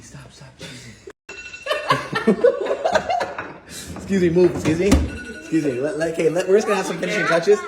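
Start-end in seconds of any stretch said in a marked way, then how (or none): 1.0-3.9 breathy high-pitched laugh. Real, not acted. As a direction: burst into airy laughter. Laughing mid-cooking.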